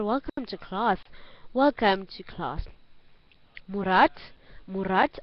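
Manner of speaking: ordinary talk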